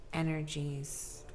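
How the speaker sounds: soft, slow, gentle listing; tender and soft. Real, not acted. Reader describing kind-hearted viewers, softly and tenderly. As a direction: say each trait tenderly.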